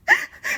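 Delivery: squealing high-pitched laugh; sudden high laugh. Real, not acted. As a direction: burst into a gleeful laugh. Laughing about sleeping kids.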